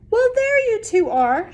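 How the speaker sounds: high, loud cheery neighbor; Miss Housett. Acted. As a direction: sing out a bright greeting. Miss Housett voiced in story.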